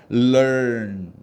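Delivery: LEARN stretched, 럴 sliding smoothly into 언; loud, drawn-out learn with a curled middle; correct gliding learn. Shown as a demonstration. Teacher gives students the model pronunciation, correct gliding learn. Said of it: "어떻게 발음해야 돼?".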